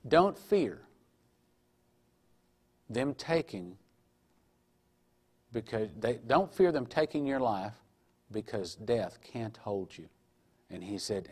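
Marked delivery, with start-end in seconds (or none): 0.1-10.1 softer, slow, reassuring words; calm him like a hand on the shoulder; Jesus comforting the man before execution, soft and slow; gentle reassurance. Retold or imitated.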